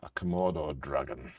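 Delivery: ordinary talk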